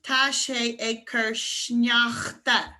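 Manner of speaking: TÁ SÉ AG CUR SNEACHTA drawn out slowly; very slow teacher's model phrase; slow demonstration. Shown as a demonstration. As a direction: stretch each word so learners can copy. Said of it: "if you wanna say it's snowing".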